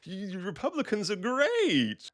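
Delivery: strained high, slow put-on voice; battered chatbot. Grok after a shovel beating, forced high praise. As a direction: squeal it out slowly like a beaten robot. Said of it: "battering it over the head until it's like".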